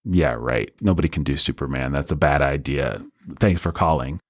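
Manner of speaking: mock dismissive. Director brushes off phone offer.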